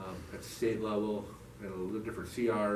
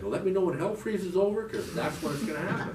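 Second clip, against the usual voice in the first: pitched-up scoffing comeback; defiant retort; 8 semitones higher, 4 dB louder than usual. Retold or imitated. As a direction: throw it back at them, higher and louder. Scornful retort to advice to forgive.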